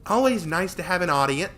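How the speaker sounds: put-on high, loud pleased girl; smug showoff. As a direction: bask in the attention. Tori showing off.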